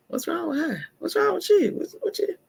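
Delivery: high mocking what's-wrong voice; mock puzzled onlookers. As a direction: squeak it like baffled busybodies. Imitating people asking what's wrong.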